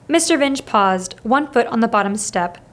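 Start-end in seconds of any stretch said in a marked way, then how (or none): none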